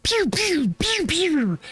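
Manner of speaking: high squeaky pew pew blaster noises; blaster sound. Shown as a demonstration. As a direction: squeak out laser shots like Star Wars. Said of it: "everybody knows this sound".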